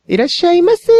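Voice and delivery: high, loud sing-song いらっしゃいませ; bright loud shop welcome. Retold or imitated. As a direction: belt out いらっしゃいませ like a clerk. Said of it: "じゃなくて".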